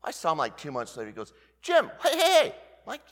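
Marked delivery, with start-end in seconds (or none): 1.6-2.5 high, loud eager hail; a friend's eager high greeting; excited calling out. Retold or imitated. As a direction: call out to grab a friend's attention.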